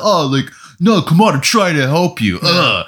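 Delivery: imitating a fed-up rescuer; exasperated rescuer. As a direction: plead in mock frustration, ending on a groan. Acts out exasperated rescuer in scene.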